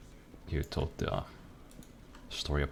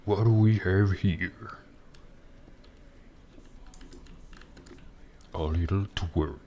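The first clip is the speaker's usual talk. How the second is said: loud, slow, high gruff orc growl; 4 semitones higher, 6 dB louder, slower than usual. Shown as a demonstration. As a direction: loom over a tiny kid as an orc. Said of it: "do the orc voice".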